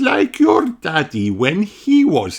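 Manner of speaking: high, slow, kindly teacher voice; a teacher explaining to her class slowly; Madame Gazelle voice. Acted. Teacher telling children about the time capsule, high and slow.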